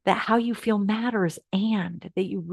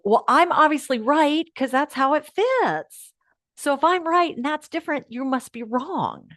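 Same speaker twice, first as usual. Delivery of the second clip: a touch higher, self-righteous certainty; lightly mock the ego's smug logic; 6 semitones higher than usual. Teacher voicing the ego judging the other side, mildly smug. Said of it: "which is go".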